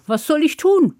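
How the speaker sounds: high, bright-eyed question; eager boy. Acted. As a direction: ask like a keen simple lad. Hans asks mother.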